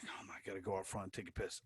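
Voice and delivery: quick, hushed aside to yourself; rushed whisper under the breath; whispered thought. Retold or imitated. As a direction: whisper it fast, urgently. Urgent thought to himself, whispered.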